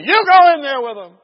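loud, high-pitched retold order; acting out a bossy shout; barked order. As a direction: order him in forcefully. Officers order him in.

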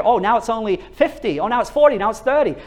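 ordinary talk, nothing marked

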